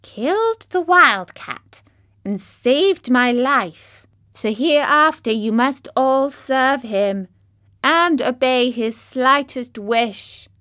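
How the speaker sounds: high, slow, loud royal decree; commanding mouse queen. Acted. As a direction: order her subjects grandly. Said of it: "she answered".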